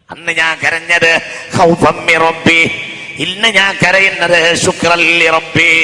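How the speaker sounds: loud, slow preaching; ringing sermon voice; full-force preaching. Acted. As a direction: preach it to the hall.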